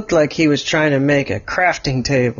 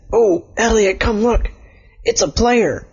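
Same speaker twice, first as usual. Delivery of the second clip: higher, eager calling out; excited call; 8 semitones higher than usual. Acted. Oliver calling Elliot to look, excited higher voice. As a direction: call your friend over to see.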